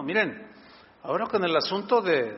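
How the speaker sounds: ordinary talk, nothing marked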